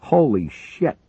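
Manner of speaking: sudden startled yell. Acted. Barnes yelling as the Sikorsky explodes, sudden shout. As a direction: shout it out in shock. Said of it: "Barnes yelled".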